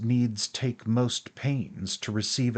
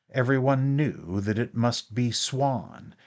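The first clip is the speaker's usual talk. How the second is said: plain reading voice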